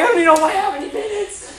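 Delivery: very high, rapid mock protest; hammed-up high-pitched panic; flustered protest. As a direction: squeak it out fast, acting overwhelmed. Acted flustered reply in quarrel.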